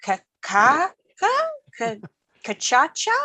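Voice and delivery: stammered "ka-, ka-" rising into a high, questioning "cachacha"; high, halting guess at "cachacha", ch twice; baffled first attempt. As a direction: imitate puzzled readers stumbling on "cachacha". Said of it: "look at that and kind of go".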